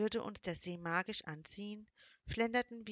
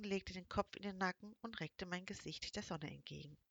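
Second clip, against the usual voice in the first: usual speaking voice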